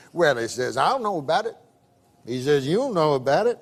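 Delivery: drawling frontier barkeep; Southern bartender. Retold or imitated. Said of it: "I can tell by the accent".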